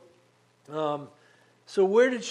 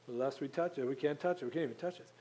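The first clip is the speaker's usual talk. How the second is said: softer, lower, "touch it" repeated more anxiously; hushed fretful rule; 4 semitones lower, 10 dB quieter, faster than usual. Retold or imitated. Preacher imitating Eve's softened overcautious rule. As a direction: murmur it like a nervous rule.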